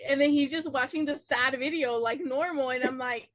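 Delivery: ordinary talk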